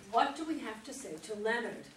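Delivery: ordinary delivery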